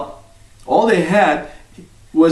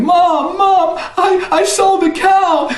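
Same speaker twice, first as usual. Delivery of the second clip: excited yell; slower than usual. Acted. Jack yells to his mother that he sold the cow. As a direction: shout the news home.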